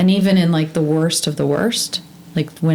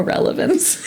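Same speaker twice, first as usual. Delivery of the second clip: laughing; 3 dB quieter than usual. Real, not acted. Laughs at own stories.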